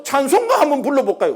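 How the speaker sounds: high, eager offer to sing; father hamming it up, keen to sing; overexcited father. Retold or imitated. Father eagerly offering the pastor's wife a hymn, high and keen. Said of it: "또 한번 오버하거든".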